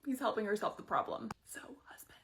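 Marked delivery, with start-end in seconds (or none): 1.5-2.2 whisper. Real, not acted. Aside whispered to viewers.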